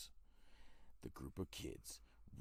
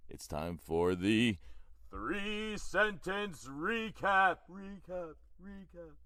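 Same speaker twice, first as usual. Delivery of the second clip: slow booming announcer with echo; 12 semitones higher, 4 dB louder, much slower than usual. Shown as a demonstration. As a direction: drag it out like a game show host. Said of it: "I'm gonna do it again anyway".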